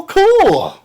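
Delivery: high, eager cool; mock excited acceptance; retold delight. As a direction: jump at the deal. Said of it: "I go".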